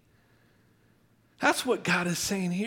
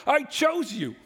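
emphatic; 9 semitones higher than usual. Acted. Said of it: "God says".

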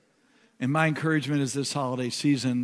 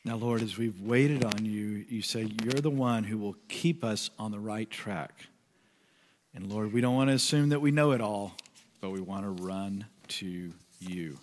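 soft, lower prayer voice; 5 semitones lower, 5 dB quieter than usual. Acted. Pastor closing the quiet time in soft prayer. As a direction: pray it gently.

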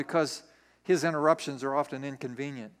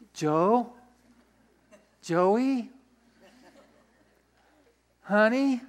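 rising, questioning call to a partner; tentative coaxing; 3 semitones higher, much slower than usual. Acted. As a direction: sweetly test the waters, pausing between names. Mary nervously coaxes Joseph before news.

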